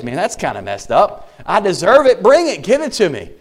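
taunt: go on, hit me; imitating a brash sinner daring punishment; defiant bring-it. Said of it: "someone think about that as".

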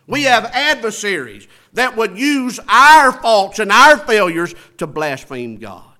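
loud pulpit declaration; forceful preaching. Real, not acted. Preacher warning the church of adversaries, forceful preaching. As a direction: hammer it out loudly like a preacher.

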